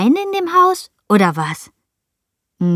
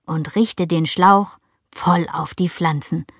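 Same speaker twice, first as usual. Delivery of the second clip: ordinary delivery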